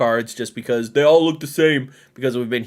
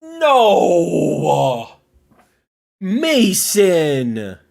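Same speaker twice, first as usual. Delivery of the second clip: loud, high playful protest; mock outcry; 6 semitones higher, 5 dB louder, much slower than usual. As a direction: cry out at Mason in mock dismay.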